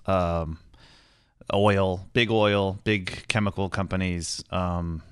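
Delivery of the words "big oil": usual speaking voice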